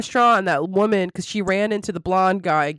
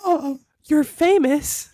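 slow, high and louder starstruck gasp of a line; mock surprise; 7 semitones higher, 3 dB louder, much slower than usual. Retelling Anne recognizing the famous chef, slow high mock surprise. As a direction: draw it out like a fan realizing who he is. Retelling Anne's reaction to Cat.

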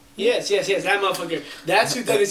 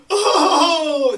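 imitating Islanders' cry; 11 semitones higher, 6 dB louder than usual. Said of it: "'cause they just go".

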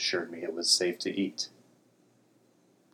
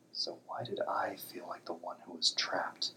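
whispering; 9 dB quieter than usual. A narrator's reading. Narrator's troubled inner thought.

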